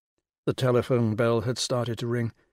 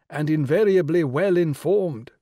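grand regal lady, pitched high and louder; rich royal voice; 4 semitones higher, 5 dB louder than usual. Acted. The Rani greeting Will on the phone, rich and regal. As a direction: sound like a queen condescending down the phone. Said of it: "the rich royal voice".

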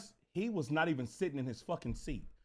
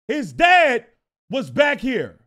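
punched out loudly, DAD stretched and hit hard; forceful, louder explanation; loud emphatic repeat; 3 semitones higher, 11 dB louder, slower than usual. Shown as a demonstration. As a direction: say it loud so they follow. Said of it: "You hear me? Let's do this again".

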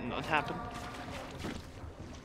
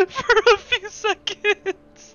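laughing disbelief; slower than usual. Real, not acted. Recounting scare laughing.

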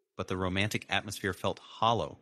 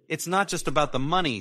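hissed; 9 semitones higher, 6 dB louder than usual. A narrator's reading. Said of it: "Sarah hissed".

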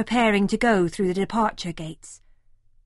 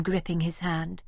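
ordinary talk

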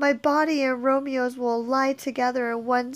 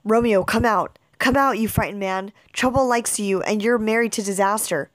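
loud, lower, firm calling; 3 semitones lower, 4 dB louder than usual. Acted. Friar Lawrence to a hiding Romeo, calling him out loudly.

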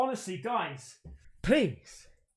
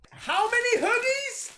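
stunned at how many there are; amazed disbelief; 12 semitones higher than usual. Real, not acted. Vlogger counting free hoodies, very high amazed question.

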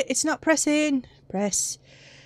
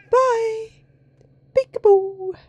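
teasing peekaboo; 10 semitones higher, 7 dB louder, slower than usual. Pretend. Teasing monster.

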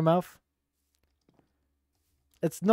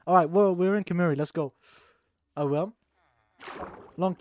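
ordinary talk

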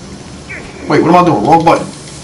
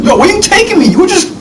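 excited. Real, not acted. NPC leading him the wrong way.

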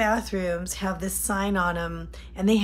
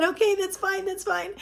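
mock husband, pitched up high and easygoing; husband's voice; 10 semitones higher than usual. Wife retelling husband's easy reply, high mock voice. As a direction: lightly imitate the calm husband, higher.

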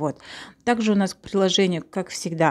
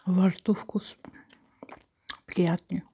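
speaking around a utensil; scoop pressed to lips; slower than usual. Real, not acted. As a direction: lips touching spatula edge. Seen 3: Long flat scoop held against her lips while she speaks.

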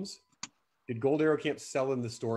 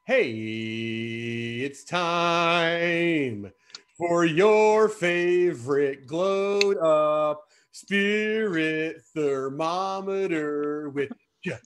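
slow, drawn-out announcer; 3 semitones higher, 3 dB louder, much slower than usual. Acted. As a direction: announce it like a show host. Announces the Cheerio segment.

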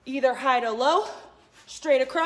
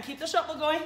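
ordinary delivery

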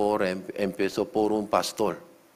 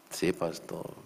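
meek reply; 4 semitones lower, 8 dB quieter than usual. Retold or imitated. Member's meek reply to the pastor.

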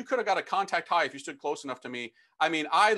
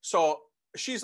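ordinary delivery

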